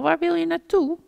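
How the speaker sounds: high, loud call after the little one; loud high worried call; big hedgehog calling out. A narrator's reading. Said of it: "riep de grote egel".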